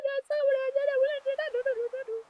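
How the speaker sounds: high, drawn-out "oh" of realization; acted realization. Streamer imagines seeing the Italian name, acted realization. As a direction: stretch it out high and thin. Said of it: "I will be like".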